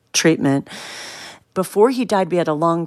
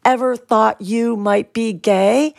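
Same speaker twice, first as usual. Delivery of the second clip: each word drawn out, very slow and careful; 4 semitones higher, much slower than usual. Retold or imitated. Daughter nervously asking her father the big question, very slow. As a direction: ease the question out slowly, bracing yourself. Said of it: "I said it so slowly".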